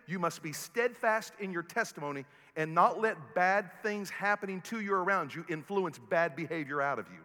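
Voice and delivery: ordinary talk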